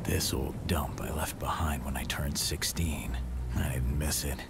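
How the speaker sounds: low hushed voice-over; whispered inner thoughts. Acted. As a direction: murmur your private thoughts, unvoiced. Inner monologue arriving home.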